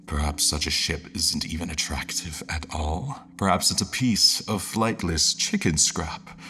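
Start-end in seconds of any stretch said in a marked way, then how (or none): none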